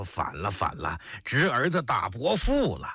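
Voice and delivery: put-on drunk man bawling from the floor; drunken hollering. Drunk uncle sprawled on the floor accusing his nephew, drunken bawling. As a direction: wail it like a drunk who just fell over. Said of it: "乱嚷起来".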